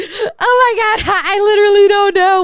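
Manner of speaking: hammed-up teary laugh-cry; crying but laughing. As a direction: sob it out while half laughing. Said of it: "crying, but laughing".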